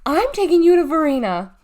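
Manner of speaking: very loud, high threat; shouted. A narrator's reading. Cashier threatens to march the girl off, shouting. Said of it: "she shouted".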